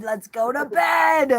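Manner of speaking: hammed-up drunken shout, slow and high; drunk chicken shout. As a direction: howl it like a drunk hen begging for bed. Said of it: "or were they like".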